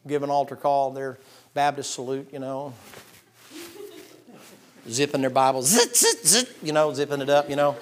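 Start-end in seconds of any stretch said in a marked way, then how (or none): 5.6-6.5 a high, loud imitated zip, zit zit; zipper noise. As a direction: make the snap of a Bible zipper with your voice. Mimicking Bibles being zipped shut.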